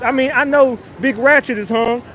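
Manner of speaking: usual speaking voice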